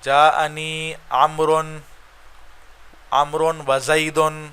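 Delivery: جاءنی عمرن said very slowly, each word spaced out; teacher dictating the Arabic example slowly to the class; slow example reading. Shown as a demonstration. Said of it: "ان کے پہلے آپ نے کہا".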